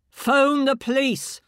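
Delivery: loud, high, slow barked command; barking bullying order. Acted. Inner voice barks orders at the shaken woman, loud and angry. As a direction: bark it like a bully. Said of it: "this awful bullying voice, barking orders".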